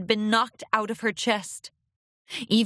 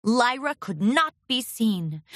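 plain reading voice

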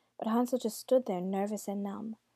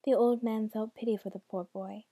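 ordinary delivery, nothing marked

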